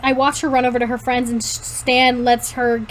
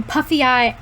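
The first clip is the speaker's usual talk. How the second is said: ordinary delivery, nothing marked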